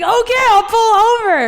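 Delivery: very high, loud mocking imitation; mock exasperated yell. Imitating her date finally agreeing to stop, mock exasperated yell. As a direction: squeal it out to mimic him giving in. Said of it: "He's like".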